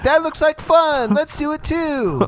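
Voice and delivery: put-on high, slow childish voice; squeaky delighted little kid. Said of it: "the kids are gonna be like".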